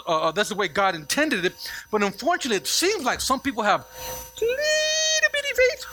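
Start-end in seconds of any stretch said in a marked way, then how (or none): 4.4-5.8 put-on very high, drawn-out, teeny little voice; comically small, high-pitched voice; tiny squeaky voice. Shown as a demonstration. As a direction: squeeze it up high and stretch it to sound tiny. Contrasting small faith with big faith.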